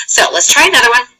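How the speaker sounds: normal talking voice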